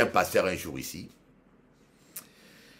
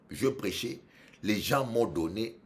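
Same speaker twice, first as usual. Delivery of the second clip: ordinary talk, nothing marked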